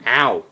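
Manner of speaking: sudden loud ow; real yelp of pain. Real, not acted. Vlogger bumping into his chair, loud real yelp. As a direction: cry out after a knock. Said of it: "That actually hurt".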